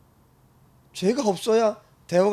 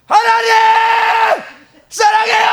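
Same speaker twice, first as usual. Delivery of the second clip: very high, very loud and slow cry; full shout to God; 12 dB louder, much slower than usual. Shown as a demonstration. Preacher demonstrating to the congregation, shouting her love. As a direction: shout it out with everything. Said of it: "그대로 한번 해볼까요?".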